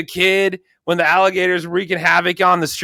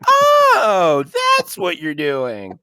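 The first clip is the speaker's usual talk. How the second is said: sudden realization; much slower than usual. Retold or imitated. Viewer reacting to the throwaway line, exaggerated realization. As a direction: act out the penny dropping. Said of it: "I was like".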